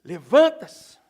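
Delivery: a sudden loud cry; one loud commanding shout. Acted. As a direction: snap it out as a command to rise. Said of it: "Jesus grita".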